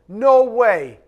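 loud, high no way; emphatic refusal. Acted. Preacher voicing God, a loud flat refusal. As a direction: slam the door on it.

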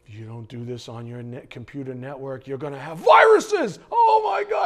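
scare the buyer, rising to a yelled panic; panicky ad voice. Pretend. Mocking a commercial for a computer network, building to a panicked yell. Acting out a fear-selling commercial.

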